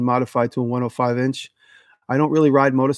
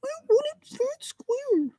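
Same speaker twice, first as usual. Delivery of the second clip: very high whispered troll, half crying; troll voice whimpering; 5 dB quieter, slower than usual. Acted. Troll voice joke again.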